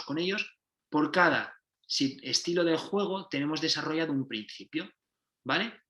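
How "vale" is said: ordinary talk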